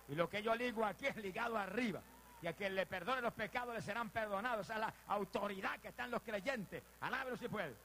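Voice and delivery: high, fervent proclamation; full-force preaching. Real, not acted. Preacher declaring the authority of believers, full-force preaching. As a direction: drive it home to the congregation.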